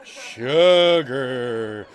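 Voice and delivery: a low spooky voice savoring the word; deep drawn-out sugar. Acted. A deep voice answering the cook, drawing out sugar.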